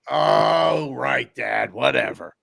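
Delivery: drawn-out, disbelieving brush-off; dismissive mock daughter. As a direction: sarcastically humor Dad. Daughters brush off dad's claim.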